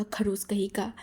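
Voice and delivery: under-the-breath whisper; whispered insult. A narrator's reading. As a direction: mutter the insult so he can't hear. Wing insults boy under breath. (फुसफुसाकर धीरे से ताना)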